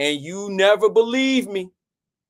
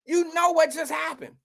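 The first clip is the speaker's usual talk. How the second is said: much higher frantic begging; desperate plea; 9 semitones higher than usual. Retold or imitated. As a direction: pitch way up, panicking. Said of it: "Now I look crazy 'cause I'm like".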